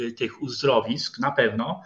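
ordinary delivery

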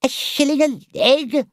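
high, loud indignant cry; outraged cry. Acted. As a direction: shriek it in disbelief, Toad-style. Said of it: "cried Toad".

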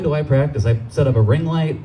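ordinary delivery

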